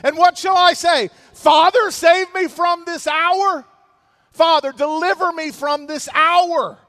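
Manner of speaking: loud, high pleading shout to the Father; anguished outcry. Acted. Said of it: "He's in anguish".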